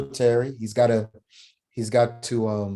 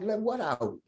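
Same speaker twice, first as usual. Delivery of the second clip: higher but much softer, a blank defiant shrug; a grieving boy brushing off his relatives, quiet and defiant; numb defiance; 7 semitones higher, 8 dB quieter than usual. Retold or imitated. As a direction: toss it back flat and closed off. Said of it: "I'm like".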